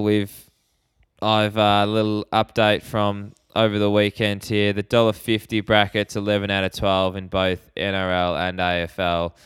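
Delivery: usual speaking voice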